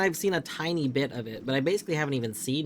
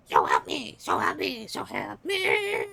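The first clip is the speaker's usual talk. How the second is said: hammed-up wail; 8 semitones higher, 4 dB louder than usual. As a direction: wail it, rising to a cry. Repeated threat, voice breaking.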